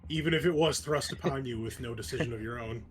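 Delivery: usual speaking voice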